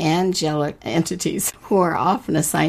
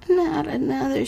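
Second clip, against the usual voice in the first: moaning; 11 semitones higher than usual. Acted. Carney moaning at the stranger, high and pleading. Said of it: "she moaned".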